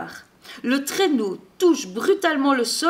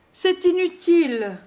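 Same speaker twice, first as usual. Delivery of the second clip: high, plaintive storybook whine; moaning; 7 semitones higher, 4 dB louder than usual. A narrator's reading. Reindeer stuck in the snow, moaning. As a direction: give up with a mild moan. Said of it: "gémissent les rennes".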